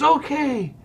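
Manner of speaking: high, cocky imitation of the manager; a hint of the old manager's swagger; mock Sparky taunt. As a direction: tease like a smug old coach. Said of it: "he goes".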